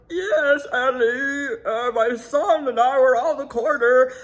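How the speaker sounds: high, loud, wailing imitation; Scott's weird voice. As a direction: mimic him in a weird high voice. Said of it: "what a weird voice".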